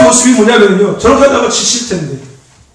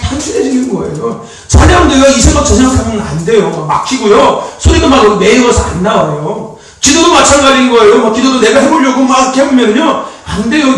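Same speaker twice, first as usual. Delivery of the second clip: usual speaking voice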